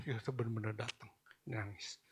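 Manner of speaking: breathy soft whisper, almost gone. Retold or imitated. Preacher recalling the father crying when food came, whispered. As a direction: drop to a whisper, moved. Telling how the man wept.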